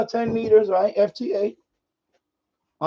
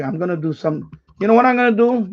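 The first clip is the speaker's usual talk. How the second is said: ordinary talk, nothing marked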